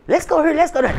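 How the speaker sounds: high, eager coaxing; high urging friends. Retold or imitated. Friends begging her to come out, high and eager. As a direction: pitch it up like pushy friends.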